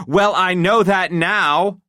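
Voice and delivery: high, tense, hurt wife voice; a betrayed wife working herself up; upset wife. A narrator's reading. Wife confronting husband about his secret, tense and hurt.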